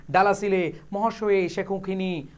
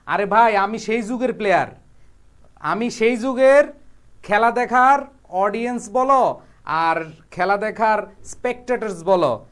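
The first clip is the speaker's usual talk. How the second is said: normal talking voice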